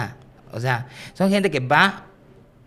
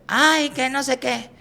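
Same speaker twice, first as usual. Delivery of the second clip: mock complaining lady; 12 semitones higher, slower than usual. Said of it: "Otra vez otra señora".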